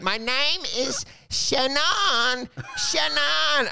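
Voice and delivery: mock-precious "Shannon", stretched as shen-AAHN; precious name pronunciation. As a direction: draw out "Shannon" high, stressing a long AHN. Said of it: "And they're like".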